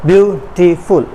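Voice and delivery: Beautiful split into three beats, view, टी, फुल; three short separate beats on beautiful; syllable by syllable. Shown as a demonstration. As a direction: sound out beautiful one syllable at a time. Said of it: "इसके तीन syllables हैं".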